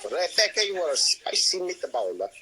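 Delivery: slow, mock Italian accent; hammy Italian accent. Playful Italian accent game.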